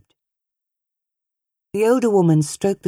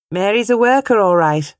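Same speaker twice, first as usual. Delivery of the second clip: proud declaring; 6 semitones higher, 5 dB louder than usual. A narrator's reading. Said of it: "her mother declared".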